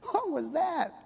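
family stunned by a stranger's odd greeting; mock bewildered question; baffled surprise. Family reacting to the strange boy.

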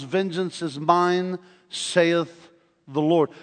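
slow, weighty quote; slow solemn reading; solemn scripture. Acted. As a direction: draw out each word like scripture.

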